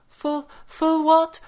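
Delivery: high, loud, slow shriek. A narrator's reading. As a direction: shriek it in panic. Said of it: "shrieked Miss Bronwyn".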